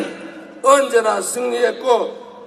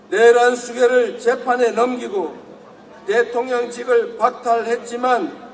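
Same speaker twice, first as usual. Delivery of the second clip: ordinary talk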